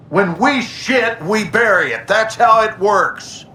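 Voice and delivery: heated. Acted. General lecturing the captain heatedly.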